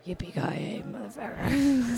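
low, slow ghostly movie-killer voice; half-hearted action-hero growl that turns spooky; attempted Bruce Willis. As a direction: sounds like the Scream killer by mistake. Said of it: "Sound like you're the Scream character".

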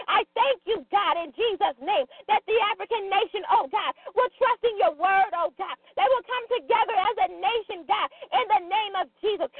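usual speaking voice

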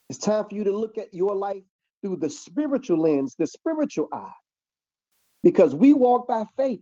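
loud, emphatic preacher cadence; preaching. Acted. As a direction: preach it to the brothers and sisters. Preaching to live feed audience.

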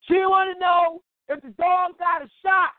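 high, loud call down the street; yelling at the door. Retold or imitated. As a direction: shout it so he hears inside. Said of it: "So I'm yelling at the door like".

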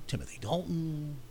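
normal talking voice

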